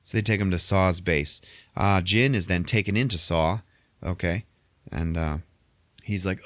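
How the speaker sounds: ordinary talk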